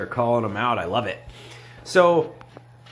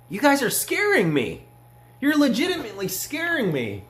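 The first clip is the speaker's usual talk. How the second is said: mock scared; 10 semitones higher than usual. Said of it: "I'm just kinda like".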